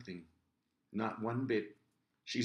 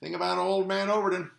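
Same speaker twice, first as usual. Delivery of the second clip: put-on old man Overton; 8 semitones higher, 8 dB louder than usual.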